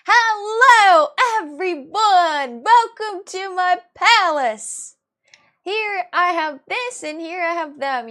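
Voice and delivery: booming, high-pitched drawn-out stage declamation; loud stage greeting. Retold or imitated. Child actor welcoming everyone to the palace, loud declaiming. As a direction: proclaim it grandly, loud and slow, like royalty in a palace. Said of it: "I was talking like this".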